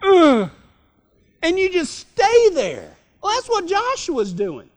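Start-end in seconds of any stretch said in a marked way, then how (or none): 0.0-0.5 self-pitying groan. Acted. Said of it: "you baptize yourself in it".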